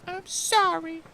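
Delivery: put-on grudging child apology, very high and loud; huffy little half-orc. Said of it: "she gets really huffy".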